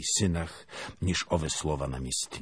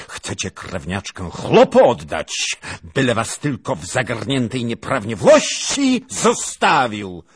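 loud, higher, furious charge; angry accusation; 8 semitones higher, 13 dB louder than usual. Acted. Lieutenant accuses the family, angry and loud. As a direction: hurl the accusation at them.

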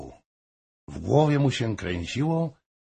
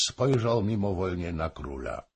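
plain reading voice

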